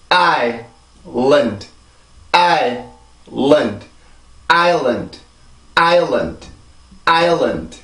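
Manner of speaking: island as EYE-lund, big beat then small beat; English two-syllable rhythm. Shown as a demonstration. Teacher modelling for repetition, island as two-beat EYE-lund. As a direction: stress island's first vowel, end on a bare D. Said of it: "이 단어는 그렇게 발음을 해요".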